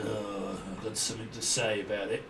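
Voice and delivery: low, quiet mock complaint; grumpy busybody. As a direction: mimic a sulky know-it-all. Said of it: "suddenly appeared up in my energy like".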